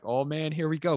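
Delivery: retold jittery anticipation; excited nerves. Speaker recalling nerves before public speaking, excited.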